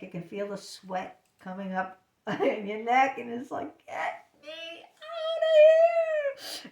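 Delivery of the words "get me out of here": long, high, loud mock wail; panicked plea. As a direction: wail it out in hammed-up desperation. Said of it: "You can feel the panic".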